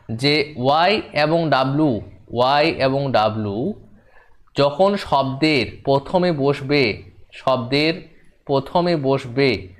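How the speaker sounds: slow, word by word, with phrases repeated; slow dictation. Real, not acted. Teacher dictating a rule into pupils' notebooks, slow and measured. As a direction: dictate it slowly enough to be written down.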